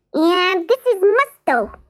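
high squeaky mouse voice; a cocky little mouse, pitched very high; cheeky mouse. Acted. Jiggs the mouse introducing himself to Campbell, high and squeaky.

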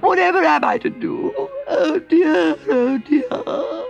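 high, slow, weepy washerwoman voice; crying Toad. A narrator's reading. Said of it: "crying again".

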